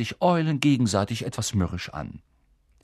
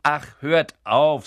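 annoyed dismissal; 5 semitones higher, 7 dB louder, slower than usual. A narrator's reading. Percy brushing off teasing.

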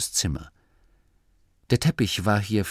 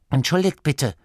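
higher, slightly raised apologetic call; rufend; 7 semitones higher, 4 dB louder than usual. A narrator's reading. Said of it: "rief Masklin". (entschuldigend herabgerufen)

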